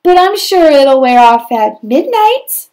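high, loud fairy voice, then reassuring; little fairy. Acted. Fairy telling Prince Cinders it will wear off, loud. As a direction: shrug off the mistake. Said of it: "said the little fairy".

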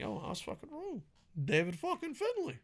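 high, hushed mock apology; mock eating crow. A wrestling fan mocking his own future apology, high and hushed. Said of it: "I wanna come here on one five and be like".

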